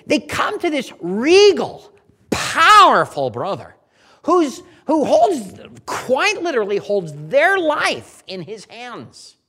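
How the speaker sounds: loud, emphatic sermon delivery; forceful preaching. Acted. Preacher retells the brothers' plea to Joseph, forceful preaching. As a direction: preach it with punched key words.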